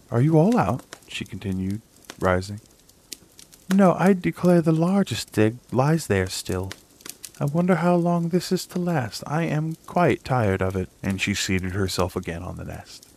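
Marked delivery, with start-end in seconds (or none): 0.1-0.8 a higher, louder motherly call; mother duck calling. A narrator's reading. Mother duck checks on her ducklings, higher calling voice. 3.7-10.9 weary mother duck. A narrator's reading. Mother duck complaining about the last egg, higher weary voice.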